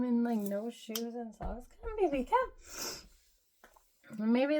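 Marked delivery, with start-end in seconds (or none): 1.8-2.5 high, loud sing-song call; calling the dog. Real, not acted. Owner calling her dog to come, high and loud. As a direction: coax the dog over, up high.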